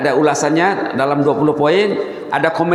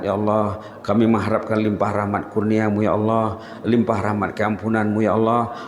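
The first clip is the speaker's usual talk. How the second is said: quick murmured Arabic supplication; rapid devout doa, slightly lower; closing prayer; 5 semitones lower, 3 dB quieter, faster than usual. Acted. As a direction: pray on behalf of the gathering, low and steady.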